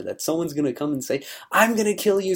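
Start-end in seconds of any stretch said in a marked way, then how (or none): 1.5-2.4 higher, louder mock-menacing threat. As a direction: ham up a bully's death threat. Someone threatening, acted out.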